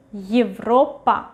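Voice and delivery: Европа with Е as a short yi, stress on the o; reduced initial Ye. Shown as a demonstration. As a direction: say Европа as yiv-RO-pa. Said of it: "we read it as Y".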